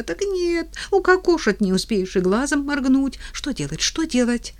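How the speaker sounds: high, rising panic; panicky. Acted. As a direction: fret aloud in alarm. Husband panics about being killed.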